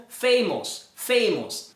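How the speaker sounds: "famous" with a round O in the last syllable; wrong pronunciation. Shown as a demonstration. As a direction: say "famous" as FAY-mohs. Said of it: "não é assim que se pronuncia".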